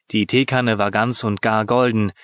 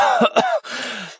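higher, short rattling cough; 13 semitones higher than usual. A narrator's reading. Genie coughing again in the smoke. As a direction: cough it out, clattering. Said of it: "schepperndes Husten".

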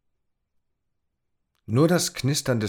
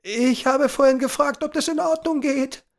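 put-on nervous boy; 5 dB louder than usual. A narrator's reading. Tom voiced, repeating himself.

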